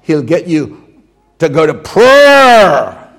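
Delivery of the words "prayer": drawn-out Irish "prayer"; imitated Irish accent. Preacher summing up in the congregation's own accent, imitated Irish. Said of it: "then the way you say".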